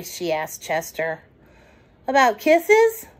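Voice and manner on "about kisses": put-on higher, louder child question; lightly voiced child; eager little raccoon. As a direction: ask like a hopeful boy. Chester asks.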